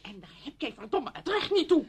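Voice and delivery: high, loud, indignant outburst; angry rebuke. Acted. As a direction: tell her off furiously. Friend angrily rejects expectations.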